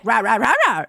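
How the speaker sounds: dog barking back in conversation; quick run of "rah" barks; imitated dog bark. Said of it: "conversing with me".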